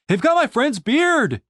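high, slow desperate yell; urgent shout. A narrator's reading. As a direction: call out in alarm. Julian shouting after wagon.

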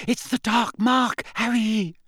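high, slow moan of dread; frightened moan. A narrator's reading. Hermione terrified, pulling Harry away from the skull, moaning. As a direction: moan it out in terror. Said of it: "Hermione moaned".

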